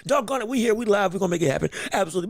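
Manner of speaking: ordinary talk, nothing marked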